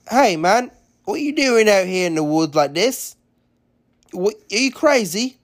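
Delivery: a hint of a swaggering kid accent; put-on kid voice. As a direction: call out to her like a brash young tough. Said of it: "I don't think he had that kind of an accent".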